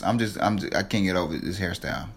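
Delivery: ordinary talk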